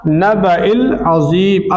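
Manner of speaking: नबइल अज़ीम chanted very slowly, each syllable drawn out; slow recitation. Shown as a demonstration. Teacher reciting the verse slowly for the student to copy. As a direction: recite नबइल अज़ीम as in careful Quran reading. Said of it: "तो अनिन नबइल".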